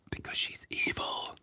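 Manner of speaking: hushed villainous whisper; mock-evil whisper. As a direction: whisper it like a cartoon villain's secret. Mock-villain reveal about drawn character.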